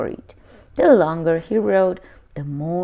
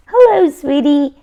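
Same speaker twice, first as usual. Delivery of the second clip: high, louder sweet grandma voice; grandma greeting; 7 semitones higher, 8 dB louder than usual. A narrator's reading. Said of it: "Henry's grandmother said to Henry".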